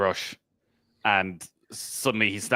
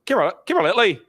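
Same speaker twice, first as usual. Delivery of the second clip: put-on higher, louder northern-accented cheer; strong northern accent; 10 semitones higher, 6 dB louder than usual. Northern spectator cheering Lee along, strong northern accent. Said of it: "these very strong northern accents".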